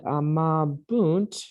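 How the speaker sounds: slow AMABUNT with an oo in the ending; teacher's careful amabunt, U not I. Shown as a demonstration. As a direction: draw out amabunt, last syllable "boont". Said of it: "it's amabunt with a U".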